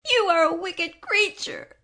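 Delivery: put-on high, loud angry girl; angry outcry. A narrator's reading. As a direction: cry out at her in anger. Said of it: "cried Dorothy".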